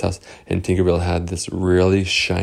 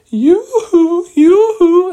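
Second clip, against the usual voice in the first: very high, loud sing-song yoo-hoo; teasing call; 10 dB louder, much slower than usual. Acted. Boys luring the guards off, very high sing-song taunt. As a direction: tease them to lure them away.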